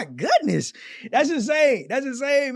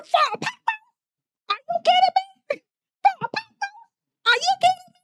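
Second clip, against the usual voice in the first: mocking her outrage. Host mimics shopper shocked at pot roast price, high mock squeal. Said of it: "Hey, did y'all hear her?".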